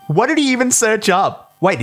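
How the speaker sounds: usual speaking voice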